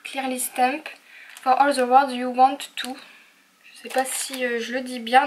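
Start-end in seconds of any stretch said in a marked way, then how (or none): 0.0-3.0 English read out with a French accent; French speaker attempting English stamp titles. Real, not acted. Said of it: "vous m'excuserez pour mon accent anglais".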